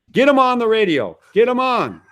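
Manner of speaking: high, insistent retold order; urgent demand. Traveller ordering the operator to radio the plane, urgent and high.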